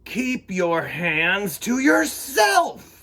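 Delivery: loud, high, slow teacher shout; stern shout. A narrator's reading. Teacher shouting at David to keep his hands to himself. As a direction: yell it like a fed-up teacher.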